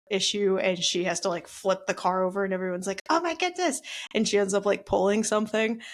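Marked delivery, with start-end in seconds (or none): 3.1-3.8 surprised gasp. Retold or imitated. Onlookers gasp at mama bear.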